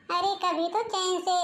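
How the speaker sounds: ordinary delivery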